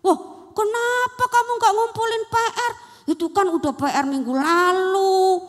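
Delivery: high, loud teacher's telling-off; teacher berating pupil over missing homework; scolding teacher. Acted.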